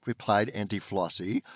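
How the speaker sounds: usual speaking voice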